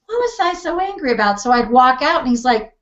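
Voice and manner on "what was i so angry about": high, light puzzled wonder; amused surprise. Retold or imitated. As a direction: wonder aloud at yourself. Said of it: "I was like".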